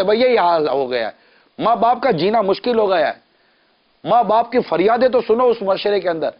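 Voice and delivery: normal talking voice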